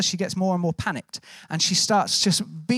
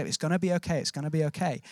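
soft, lower retold reassurance; consoling; 3 semitones lower, 5 dB quieter than usual. Wife to the young boy on the pier, softly consoling. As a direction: console gently, letting the repeat sink softer. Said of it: "trying to console him".

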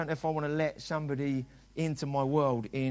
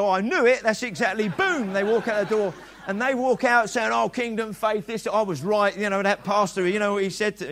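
loud, raised mimicry of a huffy churchgoer; hammed-up righteous complaint; mock offended critic; 5 semitones higher, 7 dB louder than usual. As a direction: play the indignant leaver. Voicing offended person walking out.

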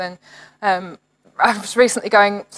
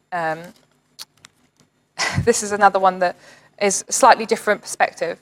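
ordinary talk, nothing marked

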